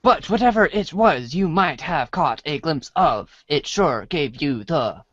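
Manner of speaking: ordinary talk, nothing marked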